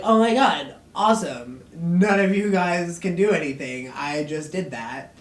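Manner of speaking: loud, thrilled boasting; hammed-up triumphant show-off; gloating villain. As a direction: crow loudly over your own power. Silas gloats, acted.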